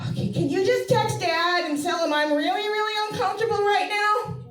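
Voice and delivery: put-on high, loud pleading kid voice; begging her mom in a high whine; pleading daughter. Daughter begging mom to text dad.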